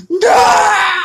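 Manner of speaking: imitating a devastated father's crying scream of no; anguished scream. Reviewer acting out Lewis screaming over his dead son, a crying shout. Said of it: "I can't do it like he did".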